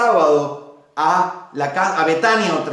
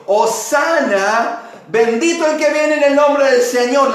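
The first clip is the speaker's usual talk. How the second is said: higher, louder, stretched acclamation; crowd shouting praise; 7 semitones higher, 5 dB louder than usual. Retold or imitated. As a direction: cry it out over the crowd. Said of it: "la gente dice".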